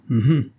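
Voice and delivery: loud ironic hum hum; mock throat clearing. A narrator's reading. Intruder clears his throat. (raclement de gorge ironique)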